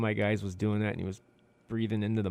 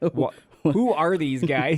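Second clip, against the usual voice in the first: slow, disbelieving, higher and louder; exasperated instructor; 6 semitones higher, 7 dB louder, much slower than usual. Retold or imitated. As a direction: drag it out like a fed-up instructor. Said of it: "she was like, shaking her head".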